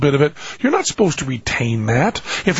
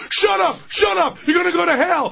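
much higher, frantic parental shouting; yelling parent. Acted. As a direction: shout the kid down in a high, strained voice. Parent yelling back at screaming kids.

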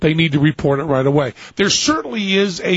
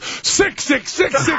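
high mock voice; 11 semitones higher, much slower than usual. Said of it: "she goes".

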